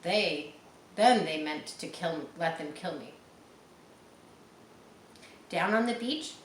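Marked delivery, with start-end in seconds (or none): none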